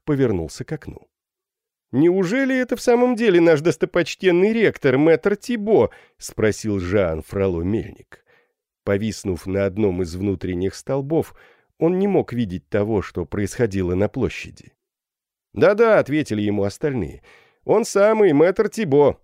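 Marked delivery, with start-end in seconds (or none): 1.9-5.9 high, loud incredulous question; mock amazement. A narrator's reading. Student mockingly asks about rector. 15.5-16.0 high, loud confirmation; eager shout. A narrator's reading. Crowd answers in chorus. 17.7-19.2 high, loud confirmation from the crowd; eager shout. A narrator's reading. Crowd answers in chorus.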